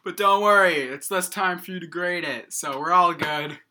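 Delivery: high, loud, breezy put-on voice; mock cheery student. Student brushing off the crash to the teacher, mock cheery. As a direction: brush it off like it's nothing.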